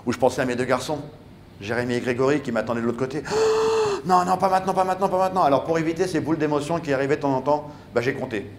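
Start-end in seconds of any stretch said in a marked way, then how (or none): 3.3-4.0 sudden high gasp of emotion; a caught, choked gasp; sharp gasp. Retold or imitated. Emotion rising again. 4.0-5.4 higher, urgent self-pleading; a rushed, rising plea of not now; panicked pleading. Retold or imitated. Fighting back tears while swimming.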